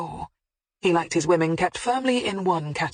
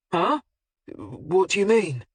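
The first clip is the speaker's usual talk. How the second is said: nervous; 2 semitones higher than usual. A narrator's reading. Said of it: "he asked nervously".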